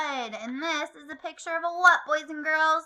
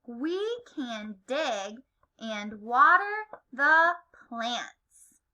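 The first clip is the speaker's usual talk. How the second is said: slow read-along; slower than usual. Shown as a demonstration. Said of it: "One more time".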